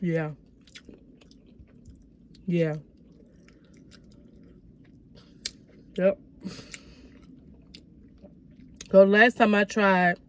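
eating while talking; chewing food. Real, not acted. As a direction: mouth partly full. Seen 3: bit wing earlier, holds wing, mouth closed, seemingly chewing.

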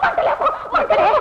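high, loud cry of burning pain; panicked yelp of pain. Acted. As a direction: cry out as if on fire. Burning woman cries out.

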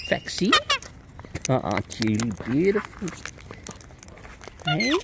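ordinary talk, nothing marked

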